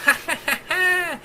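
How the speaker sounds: very high, strange mechanical ha ha ha; AI laughing at the pillow fort, high and mechanical; glitchy machine laugh. A narrator's reading. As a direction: cackle like a crackling intercom. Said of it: "the machine only laughed at his foolish escape".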